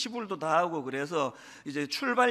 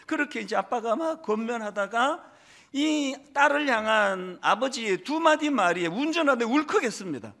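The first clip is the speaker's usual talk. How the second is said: normal talking voice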